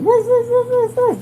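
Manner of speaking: high yapping woof woof; dog barking. Retold or imitated. The dog warning them about the snake, high barks. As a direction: bark like the family dog. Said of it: "My dog said".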